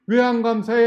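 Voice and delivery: high, loud indignant question; scolding demand. Retold or imitated. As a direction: challenge an ungrateful youngster. Adult scolds ungrateful student.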